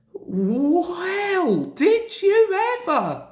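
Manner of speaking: high, loud astonished exclaim. A narrator's reading. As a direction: cry out in amazement. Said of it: "exclaimed the two old engines".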